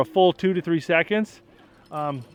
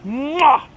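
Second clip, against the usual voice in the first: loud smacking kiss; kissing it better; 9 semitones higher, 7 dB louder than usual. Real, not acted. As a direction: smack a big kiss on a finger. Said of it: "I didn't kiss it better".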